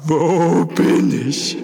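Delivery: high, loud, slow dazed moan; scared man moaning in the dark. A narrator's reading. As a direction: groan it in confusion. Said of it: "stöhnte Ede".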